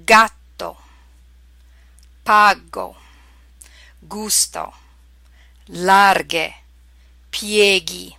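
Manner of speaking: "Gatto, pago, gusto, larghe, pieghi" one by one, every g hard; spaced-out model words with pure Italian vowels and hard g; Italian pronunciation. Shown as a demonstration. Teacher reading out example words, careful Italian pronunciation. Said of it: "here they are pronounced in Italian".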